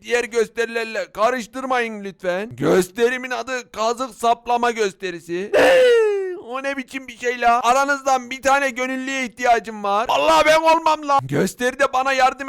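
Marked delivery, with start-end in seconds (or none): 5.6-6.4 high, sudden intake of breath; a loud startled gasp; sharp shocked gasp. Acted. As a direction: gasp in horror. Villagers shocked at the act's name.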